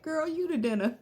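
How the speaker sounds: laughing through the punchline; laughing joke. Real, not acted. Mother joking to daughter about dinner, laughing. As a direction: crack up mid-line.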